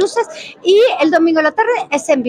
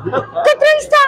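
high startled question; high mock shock; retold surprise; 12 semitones higher than usual. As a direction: shoot it up in sudden disbelief.

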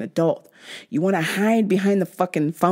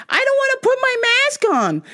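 high squeaky mock of a stubborn customer. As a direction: mimic her like she's a whiny brat. Customer refusing the mask.

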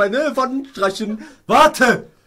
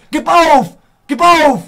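loud, higher-pitched taunt; goading shout; 3 semitones higher, 7 dB louder, slower than usual. Real, not acted. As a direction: shout it at your opponent. Taunting opponent during game.

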